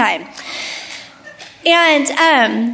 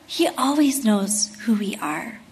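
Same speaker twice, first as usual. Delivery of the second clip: soft whispered reassurance; whispered comfort about a knowing Savior; 5 semitones lower, 8 dB quieter than usual. Real, not acted. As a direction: breathe it gently to the room. Tender preaching line.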